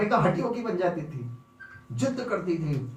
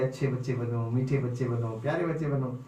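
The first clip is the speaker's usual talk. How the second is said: put-on gentle preaching to kids; 7 semitones lower, 4 dB quieter than usual. Adults preaching to children.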